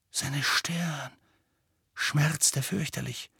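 soft, slow, pained storytelling; hushed narration. A narrator's reading. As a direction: let the pain hush the narration. Narrates pain after violent attack. (gedämpft, schmerzvoll erzählt)

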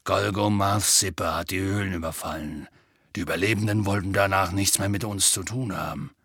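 gruff gentle giant telling bad news; sad half-giant. A narrator's reading. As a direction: speak like big sad Hagrid. Hagrid voiced in story.

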